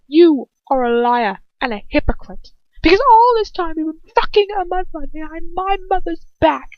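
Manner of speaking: shouted high, cutting every word; furious accusation. Acted. Son accusing his father of betrayal, shouting furiously.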